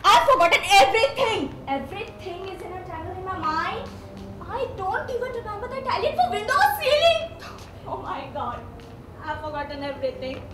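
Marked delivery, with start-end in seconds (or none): 1.7-10.4 hushed despair. Acted. Despairing, sinking low.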